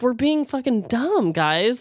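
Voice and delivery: normal talking voice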